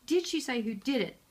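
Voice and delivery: ordinary talk, nothing marked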